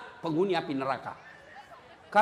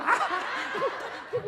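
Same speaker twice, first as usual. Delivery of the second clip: high laugh; 9 semitones higher, 5 dB quieter than usual. Real, not acted. Preacher laughing at own bit.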